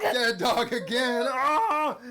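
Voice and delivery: high, loud, stretched horror yell; hammed-up jump-scare yell; shouted reveal. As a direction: shout the scare, then scream. Acting out the scary reveal.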